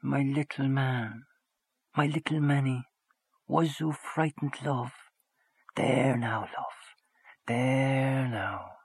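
put-on soft, high, slow cooing to a baby; tender murmur. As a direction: soothe the frightened baby in her arms.

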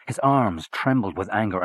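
ordinary delivery, nothing marked

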